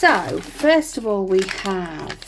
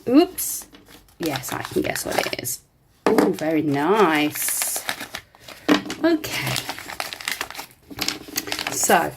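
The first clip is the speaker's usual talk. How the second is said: ordinary talk, nothing marked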